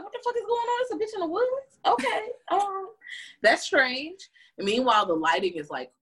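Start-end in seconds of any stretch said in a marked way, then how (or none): 0.0-4.3 high, baffled exclamation; startled confusion. Retold or imitated. Susie seeing a girl flee into the woods, startled high. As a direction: react high to something bizarre. And she like.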